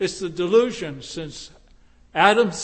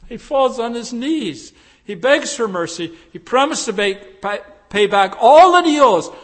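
louder, higher preaching building; building sermon; 5 semitones higher, 8 dB louder than usual. Acted. Preacher building the parable to its climax, louder. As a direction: drive the story toward its climax.

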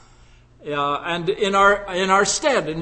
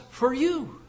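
"for you" softer and higher, set apart; stress on "for you"; 5 semitones higher, 6 dB quieter than usual. Shown as a demonstration. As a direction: lift "you" gently in "for you". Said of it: "Notice, Paul says".

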